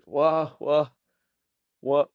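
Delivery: halting attempts building to a loud word; dazed prisoner forcing out a word; struggling for words. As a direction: stammer toward the word, then push it out. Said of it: "trying to say a word".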